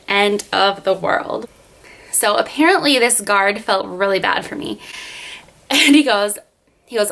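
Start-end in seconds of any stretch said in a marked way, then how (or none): none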